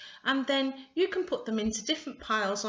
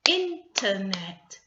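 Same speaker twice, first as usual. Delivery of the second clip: INTERNET slowly, in three clear beats; syllable by syllable; 4 dB louder, slower than usual. Shown as a demonstration. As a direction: sound out IN-TER-NET for counting. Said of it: "so that's three syllables".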